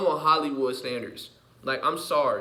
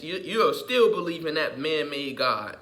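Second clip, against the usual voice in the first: ordinary talk, nothing marked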